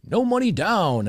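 high, slow hammed-up radio pitch; mock the cheesy radio ad hype. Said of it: "the radio stuff".

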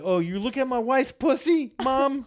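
high, loud, incredulous question; hammed-up indignant squeal of a question; mock outrage. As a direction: act out a scandalized husband confronting mom. Mocks husband confronting his mother.

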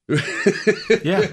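high laughing yeah. Real, not acted. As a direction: laugh it out, delighted. Laughing at pirate joke.

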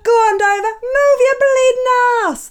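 high, loud Cockney shriek; Audrey Hepburn as Eliza. Retold or imitated. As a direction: screech it like Eliza at the races. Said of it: "she does something very similar".

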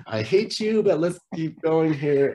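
high, loud, laughing mock spite; mock bitter resolve. Couple with three kids agreeing to stay together, mock bitter. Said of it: "we didn't say it like that".

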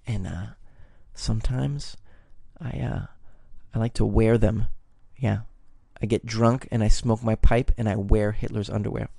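low-pitched, halting mock confession; mock confiding. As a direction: pretend to let friends in on an odd secret, low and hesitant. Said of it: "you whisper to your friends".

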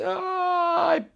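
very high, long cartoon scream; acted flying scream. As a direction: shriek as the thrown cat flies. Acts the cat screaming in flight. (chillido largo de gato volando)

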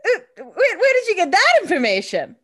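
mock shock. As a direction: gasp it out in fake alarm. Voicing Anna's shocked question.

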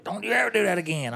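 indignant retold telling-off; big mad retelling. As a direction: imitate an angry man's warning. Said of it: "he was big mad".

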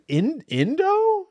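high slow "In-, Indo?" as a bewildered try; puzzled questioning. Retold or imitated. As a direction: stretch "Indo" upward in confusion. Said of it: "I'm trying out all these things".

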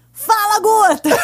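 loud, high cheerful greeting; cheerful shout. Retold or imitated. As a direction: call it out brightly over speakerphone. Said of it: "aí a Karina".

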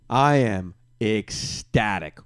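slow and savoured. Real, not acted. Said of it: "I'm ecstatic".

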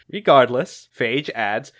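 ordinary talk

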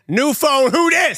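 New phone, Who dis shouted high and loud; triumphant shout. Shown as a demonstration. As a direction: yell it out triumphantly. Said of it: "I'm logging in".

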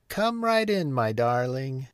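high sing-out call, falling on "darling"; grandmother's high-pitched welcoming call. A narrator's reading. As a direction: call a welcome to someone at the door. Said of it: "called the grandmother".

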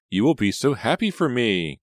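high, loud, thrilled gush. A narrator's reading. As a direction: gush with joy to a sister. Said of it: "Kate gushed".

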